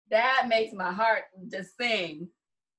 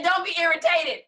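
higher, louder, playful pleading; a hint of a whiny pleading Abraham; mock pleading Abraham; 6 semitones higher, 4 dB louder than usual. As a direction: beg God not to be irritated, hamming it up. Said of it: "He said".

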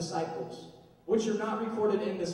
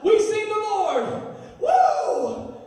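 much higher, louder, slow laughing shout; excited shout; 10 semitones higher, 8 dB louder, much slower than usual. Retold or imitated. Youth shouting revelation, laughing excited shout. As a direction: whoop it out. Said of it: "You were excited".

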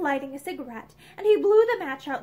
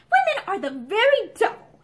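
loud, high irritated complaint; irritably raised voice; irritable outburst; 7 semitones higher, 6 dB louder than usual. A narrator's reading. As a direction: break out in exasperation. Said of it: "he broke out irritably".

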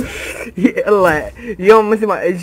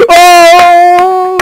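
drawn-out oh; 9 semitones higher, 8 dB louder, much slower than usual. Real, not acted. Long high oh.

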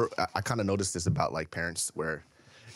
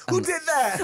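high mock baby talk; doting dad; 4 dB louder, slower than usual. Dad angling to be the comforter, mock cooing. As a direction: tease with exaggerated parental cooing.

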